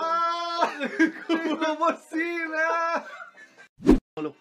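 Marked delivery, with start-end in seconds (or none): 0.0-3.0 high, long-drawn mock wail; hammed-up cry. Comic announces he has swine flu, hammed-up cry.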